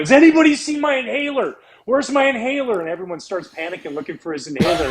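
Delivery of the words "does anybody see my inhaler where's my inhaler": high, loud mock fretting; mock panic. Comedian running the dugout searching for his inhaler, high mock fluster. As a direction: hunt for the inhaler in a hammed-up fluster. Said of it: "going up and down the dugout going".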